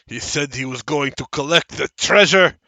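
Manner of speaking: loud declaiming with a halting search for words; strange deranged man. Acted. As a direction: proclaim it, falter, then resume loudly. Strange man reports dwarf's plan.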